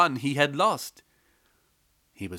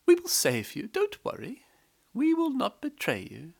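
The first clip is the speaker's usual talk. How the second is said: high, reassuring voice; reassuring wife; 11 semitones higher than usual. A narrator's reading. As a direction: soothe the frightened maid. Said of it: "Then the wife said".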